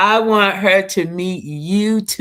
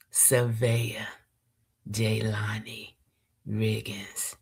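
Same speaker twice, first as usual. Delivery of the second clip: whispered, slow name in the poem; hushed name; 7 semitones lower, 8 dB quieter, slower than usual. Acted. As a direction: breathe the name softly, reverently. Names granddaughter within poem.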